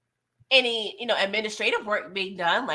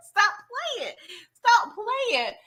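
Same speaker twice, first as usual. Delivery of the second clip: higher, exasperated stop playing; 11 semitones higher, slower than usual. Real, not acted. Host scolding the show over endless propositions, exasperated. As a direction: scold them, half laughing.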